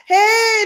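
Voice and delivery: high wailing mock sob on hey; mock crying hey. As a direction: fake a tearful wail. Mock cry greeting.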